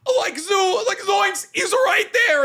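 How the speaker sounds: high, loud panicked Shaggy. Acted. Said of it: "they're being like".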